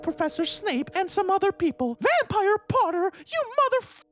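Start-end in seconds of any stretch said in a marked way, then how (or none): 2.0-4.0 mild narrated yell, pitched up. A narrator's reading. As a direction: yell it in indignation at Potter. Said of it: "I yelled".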